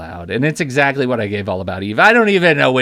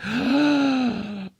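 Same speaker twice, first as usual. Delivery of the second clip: high shocked gasp; sharp surprised gasp; 12 semitones higher, 4 dB quieter than usual. Real, not acted. Shocked realization on air.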